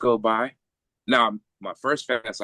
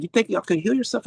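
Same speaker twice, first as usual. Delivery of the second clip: angry doctor. Retold or imitated. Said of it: "She was pissed".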